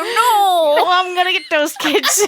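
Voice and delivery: put-on high, laughing grumpy old man. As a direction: play a cranky old man shaking his fist. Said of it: "Old man voice".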